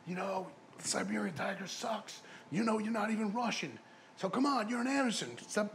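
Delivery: high mocking put-down, lightly voiced; mock taunt; quoted promoter taunt. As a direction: sneer at the fake Russian, pitched well up. Promoters mocking Siberian Tiger gimmick.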